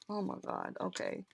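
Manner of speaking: a choked-up whisper through real tears; tearful whisper. Real, not acted. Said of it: "gonna stop crying".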